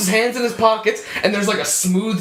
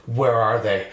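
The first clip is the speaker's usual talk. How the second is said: retold detective; 10 semitones lower than usual. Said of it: "grabs her arm and he goes".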